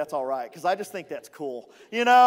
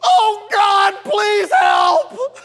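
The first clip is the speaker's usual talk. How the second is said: high, loud frantic cry; panicked plea; 13 semitones higher, 13 dB louder, much slower than usual. Pretend. Moses privately begging God in panic. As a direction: shout for help in panic. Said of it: "it goes something like this".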